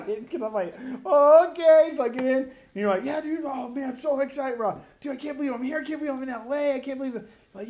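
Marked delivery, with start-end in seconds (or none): 1.0-1.9 loud, high-pitched mock okay; uneasy acceptance. Passenger told the brakes went out, high uneasy okay. 3.0-7.3 high, light-voiced mock enthusiasm; excited gushing. Imitating excited chatter on arriving in L A, high gushing.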